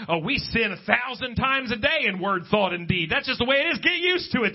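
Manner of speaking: high, dismissive parody of a complacent preacher; mocking the so-called Prince of Preachers. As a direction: send up his shrugging doctrine. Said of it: "and this man is".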